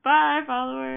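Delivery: high, loud send-off to followers; mock farewell. Mock sign-off to departing followers.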